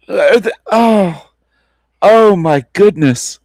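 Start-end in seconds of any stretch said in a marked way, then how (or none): none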